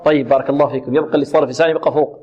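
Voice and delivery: slow, measured tajweed recitation; teacher modelling recitation; Quran recitation. Acted. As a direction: chant it slowly for students to repeat.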